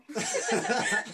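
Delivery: loud laugh. Real, not acted. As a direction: burst of loud high laughter. Laughs at own joke.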